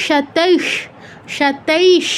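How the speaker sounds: সাতাইশ slow, long ai and held final sh; visarga as sh demo. Shown as a demonstration. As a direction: draw out সাতাইশ to a clear sh. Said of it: "এরকম". (সাতাইশ টেনে, শেষে শ)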